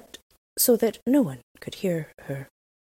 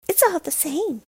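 put-on a lightly raised, bold robber girl voice; brash young robber girl, a touch higher; 12 semitones higher than usual. A narrator's reading. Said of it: "said she".

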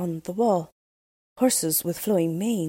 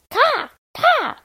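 high, loud cawing; crow caw; 8 dB louder than usual. Acted. The Crow announcing himself to Gerda with a high caw. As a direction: squawk it like a real crow. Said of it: "said he".

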